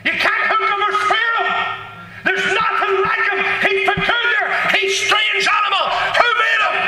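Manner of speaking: high, ringing preacher's shout with laughter; fiery preaching. Acted. Preacher telling the congregation of God's strange creature, high excited shout.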